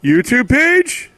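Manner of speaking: loud, high, drawn-out mock yell; exaggerated impression of an abrupt plug; imitated blurt. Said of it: "he just blurts out, like".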